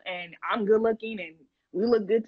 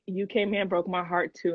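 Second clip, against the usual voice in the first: usual speaking voice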